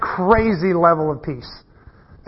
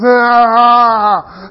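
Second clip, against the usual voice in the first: sharp guttural "Ah!" of a falling son, louder; involuntary groan; 5 semitones higher, 6 dB louder than usual. Retold or imitated. Son slips on the roof and lets out an imitated guttural groan. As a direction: imitate a sudden scared groan. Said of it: "Like, this involuntary groan".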